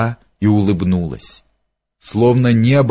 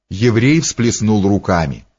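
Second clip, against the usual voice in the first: ordinary delivery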